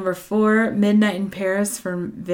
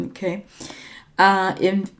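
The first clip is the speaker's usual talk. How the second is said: ordinary talk, nothing marked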